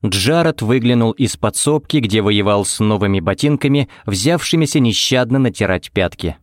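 usual speaking voice

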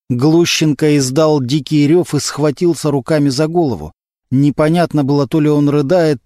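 ordinary delivery

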